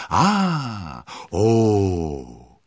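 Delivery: admiring gasps voiced loudly, falling in pitch; loud drawn-out crowd "Ah! Oh!". A narrator's reading. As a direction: exclaim a long, loud "Ah! Oh!" like an onlooking crowd. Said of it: "ertönte ein vielstimmiges".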